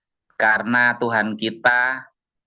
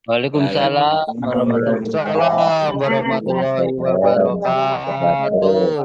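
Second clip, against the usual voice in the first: loud group reply to the greeting; 3 semitones higher, 4 dB louder than usual. Real, not acted. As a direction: answer the greeting out loud, slow at the end. Congregation answers greeting, loud.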